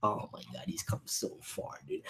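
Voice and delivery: soft, breathless whisper of awe; awed whisper. Real, not acted. Moved by how far he has come, an awed whisper. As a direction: whisper it, overwhelmed by pride.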